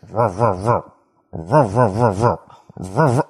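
low ruff ruff of a car that won't start; imitated engine cranking. Mimicking the drunk's car cranking, low engine noise. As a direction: mimic a car engine failing to turn over. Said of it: "all of a sudden I hear".

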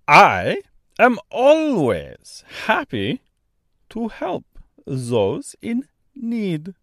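put-on slow, thick Austrian-French accent; kindly bird in Austrian-French accent. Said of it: "strangely thick, almost Austrian accent".

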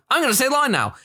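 high, paced to lip flaps; timed to lip flaps. Shown as a demonstration. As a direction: fit the line to the mouth flaps. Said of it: "in a very specific time frame".

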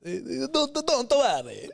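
bartender impression. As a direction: play the cheery local barman. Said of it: "He's like".

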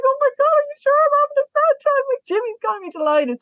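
re-enacted high squeal through tears; very high-pitched, retold emotional outburst; tearful squeal. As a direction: squeal it, overcome at the good news. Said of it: "I'm bawling, crying".

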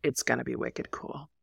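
put-on low, soft, dead-serious child; quietly grave, with a pause after Mom; deadpan kid. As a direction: imitate a deadpan daughter setting mom straight. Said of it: "most serious side-eye deadpan face".

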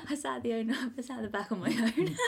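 giggling through it, a bit fast; laughing. Real, not acted. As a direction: laugh it out at your own sad story. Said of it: "it sounds so sad".

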